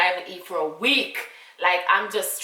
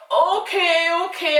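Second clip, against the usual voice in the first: put-on reluctant child; 10 semitones higher, 8 dB louder, slower than usual.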